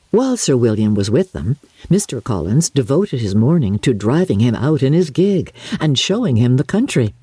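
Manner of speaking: usual speaking voice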